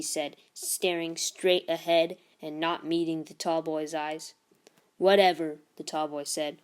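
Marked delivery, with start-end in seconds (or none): 5.0-5.6 loud, bored brush-off; bully brushing off Zeke, loudly; dismissive bully. Acted. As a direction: wave him off like he's nothing. Tall boy dismisses Zeke.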